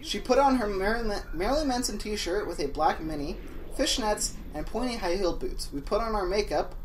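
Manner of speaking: fanfic reading. Acted. Fanfic read higher.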